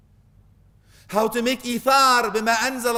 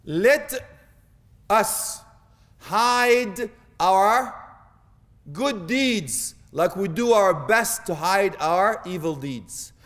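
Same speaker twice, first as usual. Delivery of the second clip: slow; 2 semitones lower, much slower than usual. Shown as a demonstration. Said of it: "stretched out lesson line".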